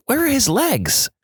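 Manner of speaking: rising panic, pitch jumping high; anxious nurse searching, voice climbing; panicked muttering. Retold or imitated. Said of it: "repeatedly muttering".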